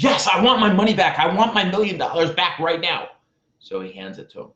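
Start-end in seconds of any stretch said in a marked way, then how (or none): 0.0-3.1 louder, higher, fast insistence; loud demand. Retold or imitated. Billionaire demands the money from the guru, loud and urgent. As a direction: demand it back like your fortune depends on it.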